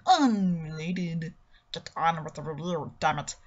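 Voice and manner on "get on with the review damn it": much lower, softer mocking inner voice; a low heckling voice inside the head.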